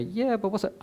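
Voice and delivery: high-pitched mock objection; objecting inner voice. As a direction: butt in as the doubting voice in the head. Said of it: "And the voice will say".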